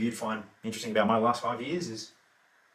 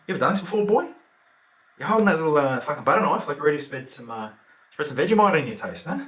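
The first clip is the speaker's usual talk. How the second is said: raised, louder jeer at an opponent; mocking taunt; 4 semitones higher, 6 dB louder than usual. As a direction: belittle him, loud and cocky. Taunting the knife man, retold.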